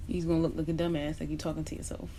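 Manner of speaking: ordinary delivery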